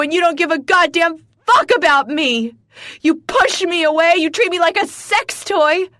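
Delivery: high, loud screaming rant, voice breaking near the end; furious screaming. Acted. Wife screams her grievances at her husband. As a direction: pour it all out at full volume.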